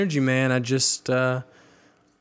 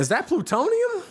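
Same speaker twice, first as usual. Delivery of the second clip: high, mock-shocked question. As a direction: act amazed at the can. Joking about energy drink.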